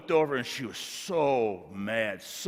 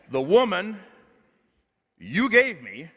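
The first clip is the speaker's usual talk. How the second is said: much higher and louder, pointing blame; Adam shouting blame at God; blaming shout; 10 semitones higher, 10 dB louder than usual. Acted. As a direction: fling the blame outward.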